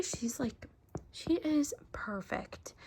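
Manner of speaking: slow adoring whisper; hushed slow whisper of delight. Real, not acted. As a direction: breathe it out slowly in awe. Gushing over doll.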